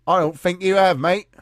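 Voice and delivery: loud, high, dismissive British officer; mock police officer. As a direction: bellow it as a mock British copper. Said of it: "what does the police officer do? Says".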